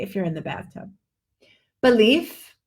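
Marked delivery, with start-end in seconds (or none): 1.8-2.5 Belief with a sharp unvoiced F; exaggerated F. Shown as a demonstration. Teacher exaggerates the F ending. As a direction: hiss the final F of Belief. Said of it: "I'm exaggerating just to show the difference".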